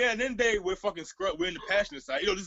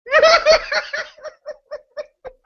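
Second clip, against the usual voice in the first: laughing; 12 dB louder than usual. Real, not acted. Big laugh at the story.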